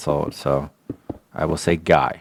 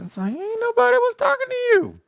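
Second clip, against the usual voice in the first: high mocking retort.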